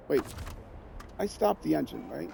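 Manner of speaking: normal talking voice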